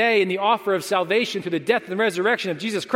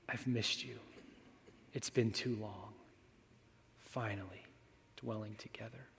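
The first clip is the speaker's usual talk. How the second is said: much softer, hushed and tender; God's tender greeting; 8 semitones lower, 19 dB quieter than usual. Acted. God welcoming the believer home, hushed and tender. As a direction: say it like a long-awaited embrace, barely above a breath. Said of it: "Those are the tears I picture on my face".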